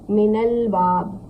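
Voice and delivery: "मिनल बाब" slowly, run together with an "a" after the n; joined Arabic reading. Shown as a demonstration. Teacher demonstrating the join to students, slow joined "मिनल बाब". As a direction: draw out "मिनल", n carrying a short "a". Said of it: "इसको हम ज़बर देंगे".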